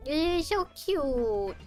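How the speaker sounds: slow, drawn-out high coo; gushing. Real, not acted. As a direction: coo over it, stretching the words. Gushing over the statue.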